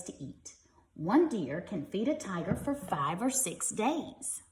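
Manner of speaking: lively, bright storybook reading; reading to children. Acted. Parent reading a tiger book to kids, lively storybook voice. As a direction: read it like a bedtime picture book.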